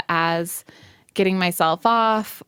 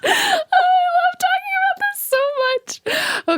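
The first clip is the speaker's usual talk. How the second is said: mock excitement. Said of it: "We're like".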